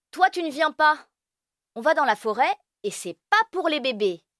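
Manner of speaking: high, quick bossy verdict; bossy big kid. Acted. Firmin bossily shutting Elio out of the forest trip. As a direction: lay down the law. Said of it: "Firmin décide".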